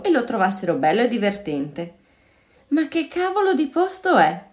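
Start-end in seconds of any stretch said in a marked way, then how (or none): 2.7-4.5 high-pitched, puzzled exclamation; a touch of irritated amazement, pitched up; surprised, a bit annoyed. A narrator's reading. Zeno asking about this strange place, surprised and a bit annoyed. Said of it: "un po' sorpreso e un po' seccato".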